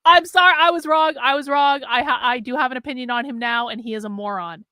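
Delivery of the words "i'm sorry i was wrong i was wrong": loud, higher-pitched retraction; emphatic mock apology. Real, not acted. Host retracts praise of Winston Marshall, loud and emphatic. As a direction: blurt the apology out loudly. Exaggerated apology after laughing at guest.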